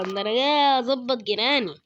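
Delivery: normal talking voice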